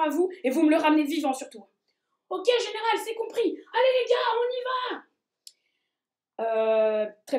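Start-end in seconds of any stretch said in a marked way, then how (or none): 2.3-5.0 high, eager little soldier; rally the troops in a high voice. Acted. Dendritic cells answer the general.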